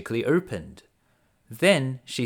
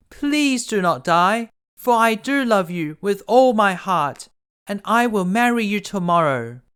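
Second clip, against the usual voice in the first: pleading cry; 8 semitones higher, 6 dB louder than usual. A narrator's reading. Beauty begs the dying beast to live, desperate plea. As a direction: beg him not to die, higher and louder. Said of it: "Beauty cried".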